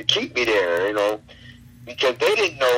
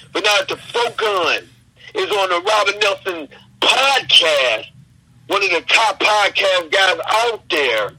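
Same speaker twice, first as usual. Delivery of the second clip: wrestling promo; 6 semitones higher than usual. Pretend. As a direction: cut a fired-up wrestling promo. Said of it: "can you do a promo on me?".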